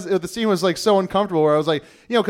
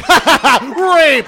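mocking crowd; 8 semitones higher, 7 dB louder, much slower than usual. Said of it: "Everyone's like".

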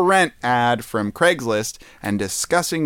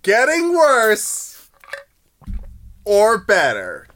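dramatic slow mock wonder; 6 semitones higher, much slower than usual. Mock-dramatic echo of ad question.

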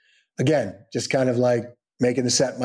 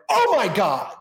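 raised-pitch retold astonishment; stunned reaction; 7 semitones higher than usual. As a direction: imitate a man suddenly taken aback. Actor stunned at meeting replacement.

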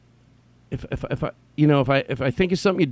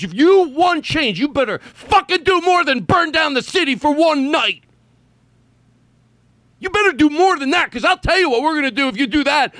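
mocking taunt; 11 semitones higher than usual. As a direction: jeer like a cruel oppressor. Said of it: "like they're taunting the people they're torturing".

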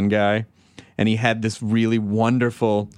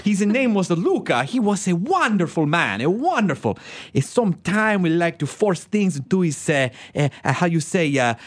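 put-on Italian accent; 8 semitones higher, slower than usual. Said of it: "I'm like".

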